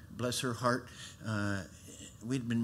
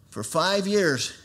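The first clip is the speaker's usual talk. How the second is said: slow, higher and louder repeat; slow, emphatic; 7 semitones higher, 7 dB louder, slower than usual. Real, not acted. Preacher repeating the years to the church. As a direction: weigh every word again.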